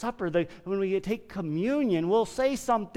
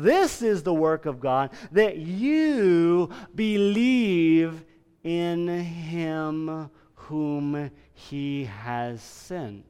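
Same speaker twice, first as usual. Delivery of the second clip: slow scripture reading; much slower than usual. Acted. Preacher quoting Jesus slowly.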